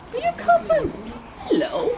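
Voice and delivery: high, lilting hello to an animal; fussing greeting. Real, not acted. Speaker greets a coughing animal at the door, high fussing greeting.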